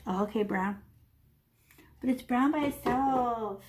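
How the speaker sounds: ordinary talk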